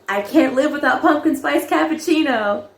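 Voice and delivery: commercial line read. Retold or imitated. Auditioning with a coffee commercial line, upbeat read. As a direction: pitch it like a cheery coffee ad. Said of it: "they asked me to say the line".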